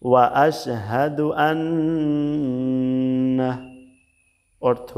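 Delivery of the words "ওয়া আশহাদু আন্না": long drawn-out syllables, measured recitation; slow Quranic recitation. Shown as a demonstration. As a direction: recite it slowly, stretching every long vowel. (ধীরে টেনে টেনে তিলাওয়াত)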